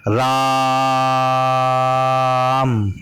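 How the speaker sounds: राम with the vowel stretched very long; drawn-out ram. Shown as a demonstration. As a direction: hold the aa in राम far past normal. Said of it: "इस तरह से दिखाते हैं प्लुत स्वर को". (राम को बहुत लंबा खींचकर बोलें)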